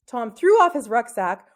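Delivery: plain reading voice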